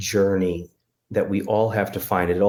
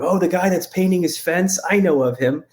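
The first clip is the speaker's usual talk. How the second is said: sudden recognition; 10 semitones higher than usual. Acted. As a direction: play delighted recognition, pitched well up. God acted, recognizing the fence painter.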